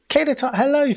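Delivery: high, loud greeting; excited hello. Real, not acted. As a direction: call out her name happily. Greeting a viewer.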